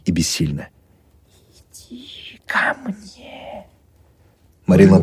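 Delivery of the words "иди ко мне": very soft, slow beckoning; eerie whisper. A narrator's reading. Possessed Marina luring the doctor, slow whisper.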